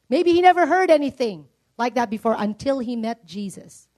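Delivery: usual speaking voice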